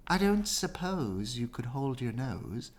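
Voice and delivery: soft, sad crocodile plea; sad and quiet. Acted. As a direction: ask gently with a sad smile. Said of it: "with a sad little smile".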